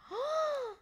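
high delighted gasp. Acted. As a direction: gasp in wonder. Storyteller gasps in delight.

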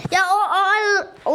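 slow, loud, stretched-out panic; drawn-out fearful stammer; panicked drawn-out stammer. Real, not acted. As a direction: stammer it out scared, dragging each word. Tense moment in jump game.

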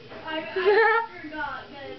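put-on high, cracked old woman's cackle. A narrator's reading. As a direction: cackle like a spiteful old aunt. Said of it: "cackled the Rani's aunt".

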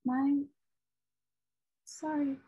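ordinary talk, nothing marked

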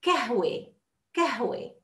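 كهوة said kahwe with a plain k; teacher showing a regional dialect. Shown as a demonstration. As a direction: swap the throat q for k in كهوة. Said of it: "Some will say كهوة".

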